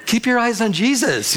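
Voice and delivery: silently scolding a rival, voiced high; exasperated retold thought; indignant inner scolding.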